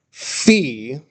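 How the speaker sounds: "Phi" modelled as the letter name; demonstrating "Phi"; teacher pronunciation. Shown as a demonstration. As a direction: model "Phi" slowly for the class. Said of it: "this is a fun one".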